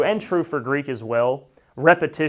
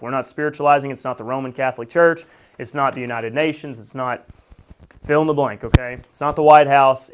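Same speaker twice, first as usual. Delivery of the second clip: normal talking voice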